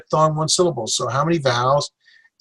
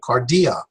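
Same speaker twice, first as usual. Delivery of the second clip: "cardia" as car-DEE-ah; stress on middle syllable. Shown as a demonstration. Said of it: "stress on the middle syllable".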